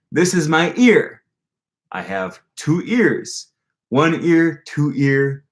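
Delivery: slow, sing-song teacher voice with high jumps on the numbers; slow repeating teacher. Retold or imitated. Teacher showing his hands and ears to the class, slow and sing-song. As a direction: spell it out slowly like a teacher to total beginners. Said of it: "slowly and repeating".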